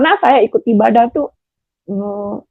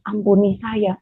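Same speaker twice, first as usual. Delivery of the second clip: quiet prayer; 8 dB quieter than usual. Retold or imitated. Led by the pastor, softly praying to Jesus. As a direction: murmur ampuni saya like a guided prayer. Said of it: "saya dituntun untuk ngomong begini".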